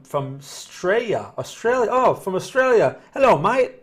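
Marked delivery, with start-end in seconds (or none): 1.4-1.9 hammed-up Aussie twang; Australian accent. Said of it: "That's my Australian accent". 1.9-3.0 higher, louder jokey Australian take on from Australia; playful mock Australian accent. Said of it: "That's my Australian accent". 3.1-3.7 higher, louder jokey Australian take on from Australia; playful mock Australian accent. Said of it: "That's my Australian accent".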